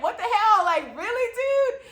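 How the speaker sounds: high incredulous what the hell; indignant. Retold or imitated. Traveler retelling her outrage at security, incredulous. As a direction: play outraged disbelief at the guard. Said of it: "You know, like".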